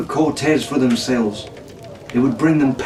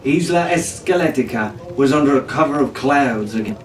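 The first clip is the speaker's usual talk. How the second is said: ordinary delivery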